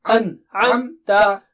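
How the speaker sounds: An-amta, slow with a shorter gap; slowly joining the syllables; syllables closer. Shown as a demonstration. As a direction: bring the parts of An-amta nearer. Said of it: "Bring them a little closer".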